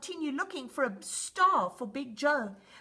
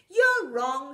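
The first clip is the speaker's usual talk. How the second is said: insistent; 8 dB louder than usual. A narrator's reading. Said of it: "she said".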